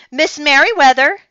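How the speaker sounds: loud, high call while hurrying. Acted. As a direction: call her name down the hall. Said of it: "he called as he walked".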